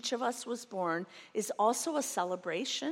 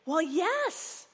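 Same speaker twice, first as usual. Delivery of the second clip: put-on high, louder eager kids' reply; a child's eager yes, higher and louder; excited kids; 9 semitones higher, 7 dB louder than usual. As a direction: answer like thrilled children. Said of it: "the kids would get more excited".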